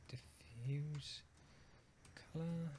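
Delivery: very soft, half-whispered murmur; muttering to self. Real, not acted. Talking to self while hunting through nodes, muttered. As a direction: murmur it softly, absently, while searching.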